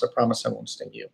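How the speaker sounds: hushed, wheedling scorpion; soft coaxing promise. Retold or imitated. Scorpion pleads with frog.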